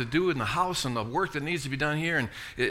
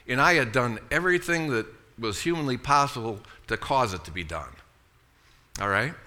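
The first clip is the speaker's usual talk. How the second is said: ordinary talk, nothing marked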